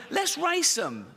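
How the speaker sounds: high mock enthusiasm; excitable, hammed-up proposal; eager suggestion. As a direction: pitch it up like a gleeful organiser. Voicing eager Special Olympics organisers.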